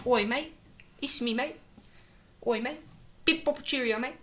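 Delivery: very high, squeaky-pitched posh British; posh British accent. Shown as a demonstration. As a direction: parody a prim upper-class Brit up high. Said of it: "like, very posh".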